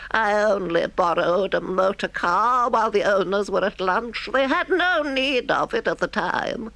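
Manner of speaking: high sheepish Toad voice. Acted. Toad downplaying his crime to the driver, high and sheepish. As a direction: brush it off in Toad's high squeak.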